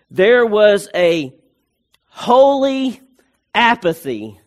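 "holy" set apart by pauses, pitched high and loud; slow, emphatic phrase, punching "holy" high; stress on HOLY. Real, not acted. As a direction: lift and lean hard on "holy". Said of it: "I stress the word holy".

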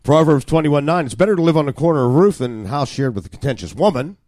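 loud, high proclamation of scripture; proverb read out. Acted. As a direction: declaim the verse from the pulpit.

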